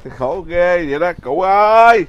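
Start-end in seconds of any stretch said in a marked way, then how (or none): 1.2-2.0 calling out. Real, not acted. Calling out to grandma.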